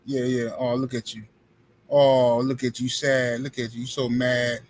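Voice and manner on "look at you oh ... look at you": normal talking voice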